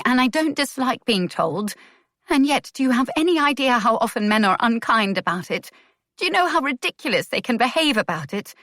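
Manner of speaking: a woman confronting the man who judged her; shaking anger. A narrator's reading. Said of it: "her voice was shaking".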